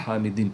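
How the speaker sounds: حامدن ending in kasra, hamidin, falling and quiet; with zer. Shown as a demonstration. Teacher shows the zer form, hamidin, softly. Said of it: "زیر کے ساتھ".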